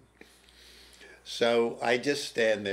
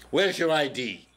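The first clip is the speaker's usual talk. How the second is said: curt demand; 5 semitones higher, 6 dB louder than usual. Retold or imitated. Guard demands ID.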